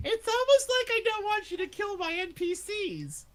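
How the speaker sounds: very high, loud mock outburst; sarcastic protest. Real, not acted. Game master accused of bullshit, loudly sarcastic. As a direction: squeal it in exaggerated sarcastic protest.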